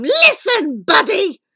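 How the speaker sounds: high, loud queen's shout; imperious, bossy yell; shouted order. A narrator's reading. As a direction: shout to grab his attention. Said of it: "She shouted at him".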